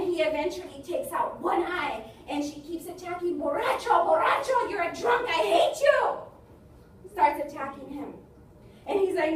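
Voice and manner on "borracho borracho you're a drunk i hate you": high, very loud accusing yell; furious attack. Acted. Attacker to the drunk she claws, furious shouting. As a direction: yell each insult as a blow.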